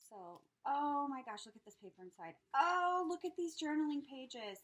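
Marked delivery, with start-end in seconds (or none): 2.5-4.6 high, loud burst of delight; delighted exclamation. Real, not acted. Showing off journaling pages, delighted exclamation.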